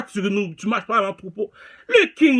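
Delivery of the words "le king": high, loud proclamation; loud boast. Real, not acted. Rapper boasting to rivals about himself, loud proclamation. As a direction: announce it like a title.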